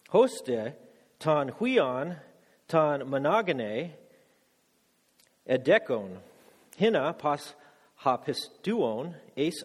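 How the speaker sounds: "Houtas gar agapesen hatheas ton kosmon" read slowly in careful Koine Greek; careful recital of the Greek verse. Shown as a demonstration. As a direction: read the Greek phrase by phrase, slow and deliberate. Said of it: "I'll read it in Greek".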